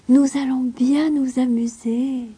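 put-on high, slow, happy girl's voice; laughing delight. A narrator's reading. Clara cheering to Heidi once the governess left, glad. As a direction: delight in the new friend. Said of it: "s'écria en riant".

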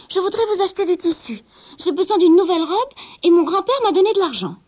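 put-on girl keen on her new dress; young girl. Rosabelle parle à la marchande. (jeune fille enthousiaste, voix aiguë)

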